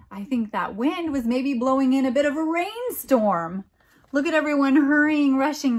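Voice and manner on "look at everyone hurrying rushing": louder, eager pointing out; excited. Real, not acted. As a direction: urge them to look at the rush. Excited about storm picture.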